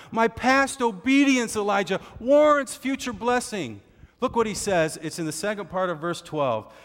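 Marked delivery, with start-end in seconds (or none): 0.1-3.8 indignant shout. Retold or imitated. Obadiah loudly claiming his reward from Elijah. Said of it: "Write that down".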